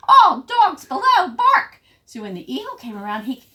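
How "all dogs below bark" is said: high, loud warning cry; shouted alarm bark. A narrator's reading. Watchdog warning all dogs below, high urgent shout. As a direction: holler it out as an alarm.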